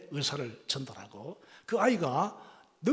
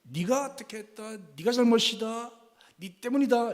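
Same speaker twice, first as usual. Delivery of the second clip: accusing, rising into a louder blame; blaming; 6 semitones higher, 5 dB louder than usual. Shown as a demonstration. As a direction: point the finger with growing force. Second "you message" example. (비난하는 말투)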